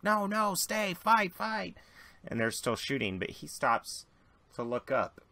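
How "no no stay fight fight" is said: high, urging cries to stay; desperate gang members. Acted. Gang members begging deserters to stay and fight, high urgent. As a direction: plead with them to keep fighting. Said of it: "the others are like".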